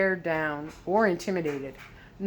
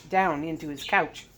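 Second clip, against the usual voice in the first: usual speaking voice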